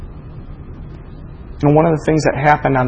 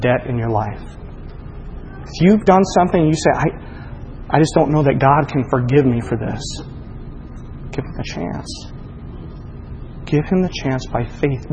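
soft, hushed reassurance; gentle appeal; 7 dB quieter than usual. Acted. Pastor softly reassuring a guilty listener. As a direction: coax them gently to trust God.

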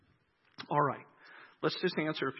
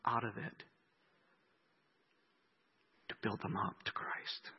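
hushed; 4 semitones lower, 6 dB quieter than usual. Acted. Quiet preaching moment.

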